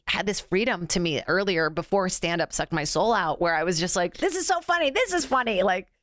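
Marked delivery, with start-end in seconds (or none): 4.2-5.6 high-pitched excited self-quote; carefree enthusiasm. Retold or imitated. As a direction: act out your giddy, unjudging younger self. Quotes younger self's carefree enthusiasm.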